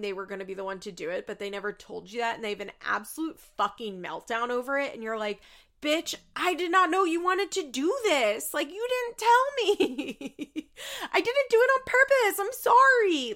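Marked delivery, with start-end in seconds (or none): 5.8-13.3 high, loud wail breaking into laughter; exasperated mock protest. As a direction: plead loudly in hammed-up exasperation. Acted protest at child's meltdown.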